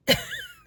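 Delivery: short high squeak of a laugh; a burst of disbelieving laughter; squeaky laugh. Real, not acted. Laughs at own outburst.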